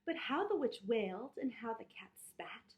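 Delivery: put-on lively read-aloud for children; story narration.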